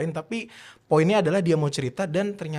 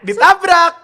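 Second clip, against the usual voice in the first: excited exclamation; 14 semitones higher, 14 dB louder, slower than usual. Real, not acted. Punchline of the traffic trick.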